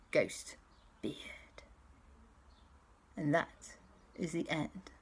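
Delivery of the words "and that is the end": bedtime whisper. Acted. Parent whispering the story's end at bedtime. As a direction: hush it down for sleep.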